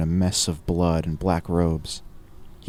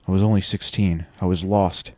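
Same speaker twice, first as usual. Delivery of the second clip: usual speaking voice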